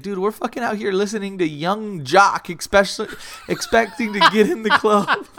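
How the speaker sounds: high-pitched rant breaking into laughter; amused disbelief. Retold or imitated. Imitating a friend mocking their own club attempt, laughing disbelief. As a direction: build to a loud, laughing finish.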